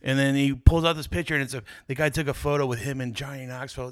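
ordinary talk

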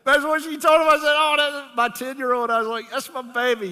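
loud, high, excited crowing; proud father boasting. Real, not acted. Proud father boasting about his daughter.

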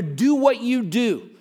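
normal talking voice

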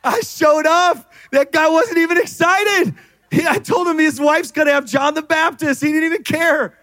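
high, incredulous, indignant angel; Gabriel. Acted. Angel recounting his visit to Zechariah, high and incredulous. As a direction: vent in disbelief, pitched well up. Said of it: "like, "Gabriel, tell me about that time".

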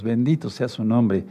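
ordinary delivery

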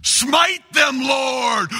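high, loud booming smite cry; mock biblical prophet. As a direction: shout it like a wrathful prophet. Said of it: "in Bruce Almighty".

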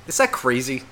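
ordinary delivery

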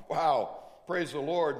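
ordinary talk, nothing marked

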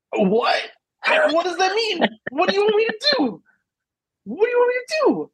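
incredulous disbelief. Retold or imitated. Mom retelling a neighbor's odd remark, incredulous high-pitched disbelief. Baffled by the other mom's remark.

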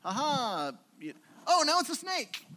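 high, loud showman's reveal; hammed-up magician's flourish; mock magician. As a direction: play a smug trickster unveiling his trick. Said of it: "put the stick".

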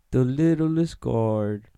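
slow, drawn-out storybook title; storybook narration. Pretend. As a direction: announce it like a children's book title.

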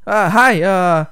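imitating an awkward guy, loud and high; awkwardly loud. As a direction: blurt it out too loudly, mimicking him. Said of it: "this is how this guy acts. He's, like, awkwardly loud".

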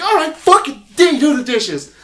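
high, loud exasperated order; mock yell. A character yelling at Dee to do the dishes.

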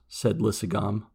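ordinary delivery

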